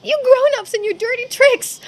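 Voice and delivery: high, loud cry of betrayal; loud childish protest at the adults' dirty trick; indignant outburst. Acted. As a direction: yell it at the sneaky adults. Girl outraged at adults' trick.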